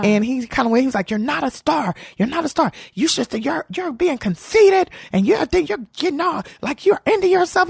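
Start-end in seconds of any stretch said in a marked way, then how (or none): 1.1-7.7 high-pitched scolding imitation; mock an angry comic berating someone; going off. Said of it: "he was going off on me".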